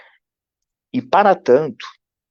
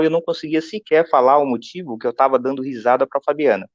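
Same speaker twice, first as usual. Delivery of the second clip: ordinary talk, nothing marked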